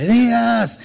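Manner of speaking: high call of the name across the tent. Retold or imitated. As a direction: call to him from the other side. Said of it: "Aquí le gritaba". (llamada alta de un lado al otro)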